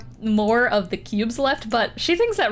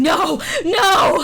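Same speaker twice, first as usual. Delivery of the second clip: high, loud cry of "no"; protesting cry; 8 semitones higher, 5 dB louder than usual. Acted. Dragon crying out in protest.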